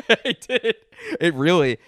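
loud, high burst through a laugh. Real, not acted. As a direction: insist while cracking up. Cracks up insisting on it.

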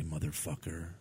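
very low, soft Steven Seagal voice. Shown as a demonstration. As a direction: say it low and calm. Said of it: "very low".

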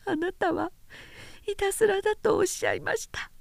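put-on high, soft weeping young woman; tearful young woman. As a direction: plead softly through tears. Voices Nanae in tears.

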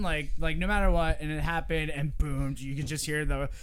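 usual speaking voice

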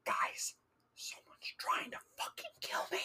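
urgent hushed whisper; frantic whisper. Retold or imitated. Student waking roommates in their room, frantic whisper. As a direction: whisper it as a panicked warning. Panicked warning to sleeping roommates.

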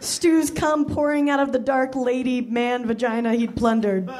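normal talking voice

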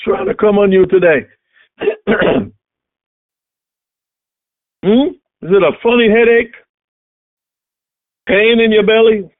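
loud, high preaching; fiery preaching. Acted. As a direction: proclaim it over the congregation. Preaching at full force to sickness.

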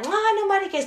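high, loud careless brush-off; dismissive brush-off. Retold or imitated. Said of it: "And I was like".

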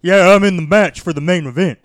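loud, high brash wrestler voice; loud wrestler impression. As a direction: shout it like a wrestler promo. Mimicking wrestler Eddie Kingston.

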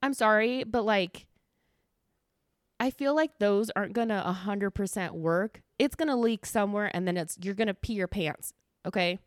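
ordinary talk, nothing marked

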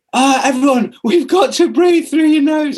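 high, slow, hammed-up evangelist voice; annoying preachy enthusiast. A breathing convert lecturing everyone, high, slow and preachy. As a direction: preach nose breathing to everyone like a know-it-all. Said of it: "I became this really annoying person that was like".

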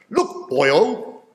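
a faint stab at a Welsh minister's lilt; a light Welsh "boyo"; Welsh accent attempt. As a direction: try the Welsh accent and half miss it. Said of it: "I can't do a Welsh accent".

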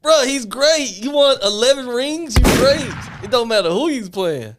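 high, loud and drawn out; frat bro. Real, not acted. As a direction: hammer the point home slowly. Heated argument about Bill Russell.

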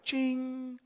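a high, bright ding of realization; high ching sound. Acted. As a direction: ring it out high like a bell.

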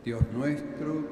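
ordinary talk, nothing marked

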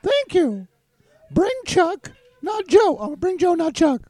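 high squeaky cartoon voice; silly high mock tough-guy squeak; Mickey Mouse voice. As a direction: push the pitch way up like a cartoon mouse. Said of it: "in a ridiculous voice".

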